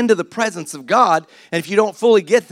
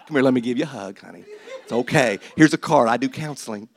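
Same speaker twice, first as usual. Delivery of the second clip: mock-soothing husband; 7 semitones lower than usual. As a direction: soothe an angry wife, low and gentle. Voices husband soothing angry wife.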